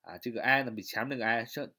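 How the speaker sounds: ordinary delivery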